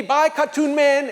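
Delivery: high, drawn-out mock goodbye; soldiers' cheery farewell. Soldiers waving as he drives out, high cheery farewell. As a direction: sing out a slow, friendly send-off. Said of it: "they wave at me".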